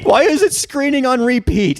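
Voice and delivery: high, incredulous mock-horrified question; appalled disbelief. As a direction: play it aghast. Acting appalled at Atris.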